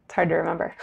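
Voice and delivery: ordinary talk, nothing marked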